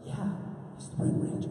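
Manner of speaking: hushed, secretive whisper. Retold or imitated. Boy answering his friend's secret, whispering. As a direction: whisper back to your best friend. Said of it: "a whispering conversation like this".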